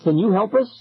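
high, rising appeal; urgent plea. A narrator's reading. Lost kid to strange circle, urgent plea. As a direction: ask urgently, lifting at the end.

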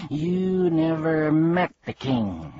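angry accusation. Acted. Bee accusing the humbug of lying, slow and angry. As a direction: call his lie out sharply. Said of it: "accused the bee angrily".

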